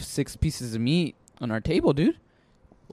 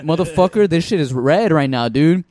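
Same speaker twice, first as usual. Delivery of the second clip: loud, raised-pitch mock outrage; exasperated protest; 4 semitones higher, 7 dB louder than usual. Diner protesting more meat to waiter, loud comic exasperation. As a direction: protest loudly like a fed-up diner.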